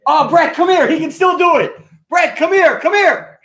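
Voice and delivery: high-pitched, loud, eager summons; excited calling. Real, not acted. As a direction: call your son over, bursting with excitement. Eagerly calling son over.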